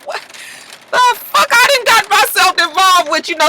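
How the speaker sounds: very high, exasperated retelling; mock outraged disbelief. Bishop hears what she told, hammed-up outrage. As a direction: voice his shock way up high.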